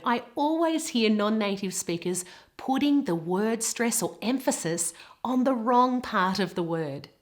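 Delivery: ordinary talk, nothing marked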